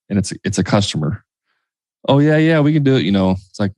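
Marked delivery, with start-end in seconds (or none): 2.1-3.0 lightly imitated eager-to-please contractor; obliging phone voice. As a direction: pitch it up, agreeable, as if to a customer. Imitating electrician taking customer's call.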